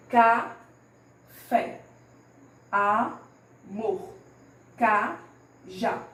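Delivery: "Café, amor, cajá" in separated syllables; final-syllable stress. Shown as a demonstration. Teacher gives examples of oxítonas, syllable-by-syllable final stress. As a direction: split each of "Café, amor, cajá" into two slow syllables. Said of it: "a palavra que eu pronuncio com mais intensidade".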